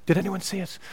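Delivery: hushed whisper, rushing to get it over; embarrassed whispered prayer. Retold or imitated. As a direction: mumble grace so nobody notices. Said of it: "he wouldn't give thanks like this".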